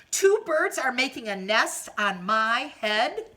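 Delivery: loud rising disbelief on "Two birds"; dismayed question. Acted. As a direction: ask in horror.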